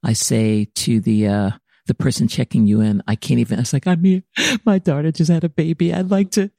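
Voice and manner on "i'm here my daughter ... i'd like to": high, overwrought begging; retold distraught plea. Grandmother to hospital check-in person, acted-out pleading. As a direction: plead at the desk, pitched up.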